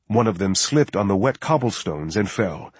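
ordinary delivery

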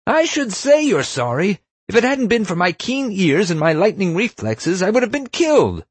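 high, screechy indignant scolding; outraged tirade. A narrator's reading. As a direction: berate them as a hysterical principal. Enraged principal keeps berating the student.

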